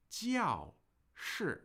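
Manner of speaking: 教室 with both 教 and 室 falling sharply; two hard drops on 教室; two fourth tones. Shown as a demonstration. Teacher modelling 教室 for the class, two falling tones. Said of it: "The fourth tone is the falling tone".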